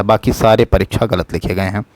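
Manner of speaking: ordinary talk, nothing marked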